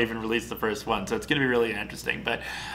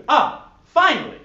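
excited; 14 semitones higher, 7 dB louder than usual. Acted. Niles reaches his goal.

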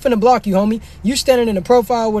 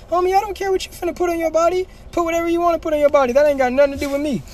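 much higher, faster, fed-up mock voice; high exasperated repeat; 8 semitones higher than usual. Streamer re-answering the viewer, high and fed up. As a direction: fling the answer back, hammed up high.

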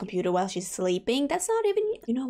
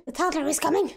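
higher, alarmed second warning; alarmed repeat; 7 semitones higher, faster than usual. Real, not acted. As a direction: raise the alarm the second time round.

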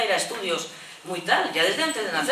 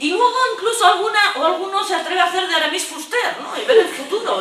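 laughing, much higher and louder; 11 semitones higher, 5 dB louder than usual. Real, not acted. Teacher laughing about strangers predicting the future. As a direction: giggle out the fortune-teller bit.

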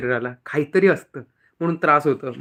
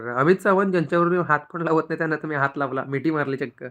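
ordinary delivery

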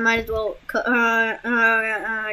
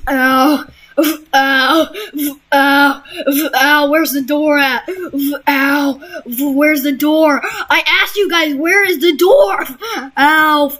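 mock pain yelps; 3 dB louder, slower than usual. Concussion, crying out in pain.

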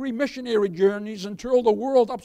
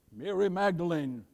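usual speaking voice

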